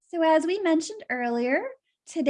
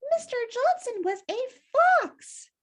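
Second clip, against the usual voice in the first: frightened; 11 semitones higher than usual. Acted. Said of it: "nearly died of fright".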